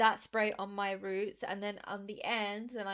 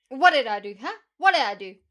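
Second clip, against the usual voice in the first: much louder, exclaimed self-reproach; sudden loud, comic protest; mock outburst; 2 semitones higher, 16 dB louder than usual. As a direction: yell it in mock exasperation at yourself. Mock outburst at own failings.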